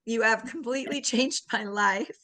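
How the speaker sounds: real emotion catching in the throat, with a small laugh; fighting back happy tears as she thanks her friend; grateful voice thickening with feeling; choked up. Real, not acted. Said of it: "I'm getting choked up".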